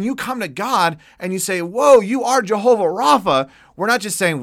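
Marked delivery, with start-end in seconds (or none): 1.6-3.5 loud, raised cry of wonder; awed exclamation. Acted. Preacher voicing a worshipper's awe, loud amazed cry.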